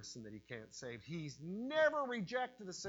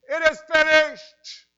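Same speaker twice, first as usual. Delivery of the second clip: full-voiced, drawn-out high shout; triumphant shout, slow and very loud; crying out; 13 semitones higher, 23 dB louder, slower than usual. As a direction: cry it out with all your might. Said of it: "he cried out".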